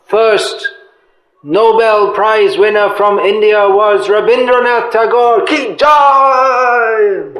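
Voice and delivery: high, loud mock fanfare building to a drawn-out cheer; sarcastic acclaim. Preacher mocking a celebrated poet to devotees, sarcastic acclaim. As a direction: hail him with exaggerated, sarcastic triumph. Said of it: "I'm being sarcastic".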